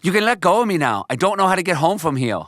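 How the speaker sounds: put-on high little boy voice, resigned. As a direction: give in like a small boy, pitched high. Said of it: "he says".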